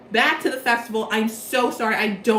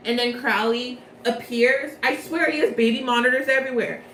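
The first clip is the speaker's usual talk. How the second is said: normal talking voice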